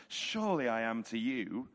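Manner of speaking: ordinary delivery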